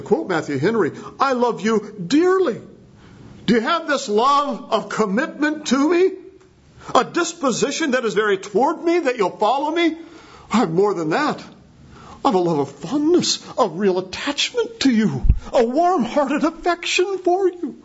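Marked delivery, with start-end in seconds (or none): 1.2-2.6 high, heartfelt stress on "dearly"; emphatic declaration. Retold or imitated. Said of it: "more emphatically". 3.5-10.0 high-pitched imploring question, lightly dramatized; acted earnest question. Acting out Jesus's question to Peter. 10.5-17.8 earnest declaration climbing higher; emphatic avowal. Retold or imitated. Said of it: "more emphatically".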